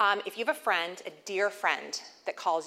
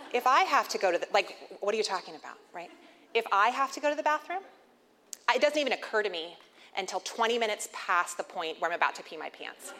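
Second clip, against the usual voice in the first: normal talking voice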